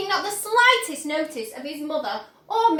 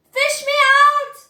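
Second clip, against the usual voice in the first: high, loud plea; desperate yell; 11 semitones higher, 10 dB louder than usual. Acted. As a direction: beg to be pulled out. Boy drowning in chocolate.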